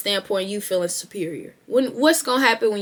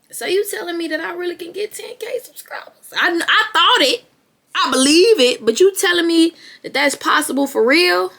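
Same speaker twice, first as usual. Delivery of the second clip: much higher, louder, gushing disbelief; mock amazed; 9 semitones higher, 6 dB louder than usual. Hearing ten K is possible, a hammed-up amazed gush. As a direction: overplay wide-eyed wonder at the news.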